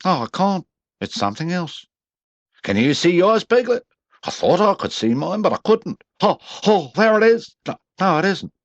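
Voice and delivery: high, eager little voice; small animal voice. A narrator's reading. Roo and Piglet peering over the bridge, high eager voices. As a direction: chatter like an excited small child. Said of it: "cried Roo".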